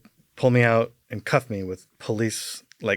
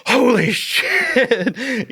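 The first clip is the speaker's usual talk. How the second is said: drawn-out, high, laughing holy shit; stunned, laughing disbelief; laughing amazement; 12 semitones higher, 5 dB louder, much slower than usual. Retold or imitated. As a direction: stretch it out through a laugh. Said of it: "I get this adrenaline".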